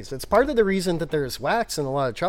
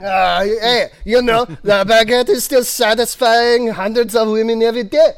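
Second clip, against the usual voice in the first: higher, louder hammed-up French accent; over-the-top French innuendo voice; mock French accent; 8 semitones higher, 11 dB louder than usual. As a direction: brag like a cheesy French lover. Continuing French accent joke.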